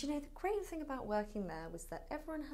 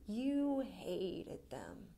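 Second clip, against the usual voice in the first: very slow, drawn-out accusation; deliberate accusation; 2 semitones higher, much slower than usual. Acted. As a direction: stretch every word at the accused. Acted accusation of Pilate, drawn out.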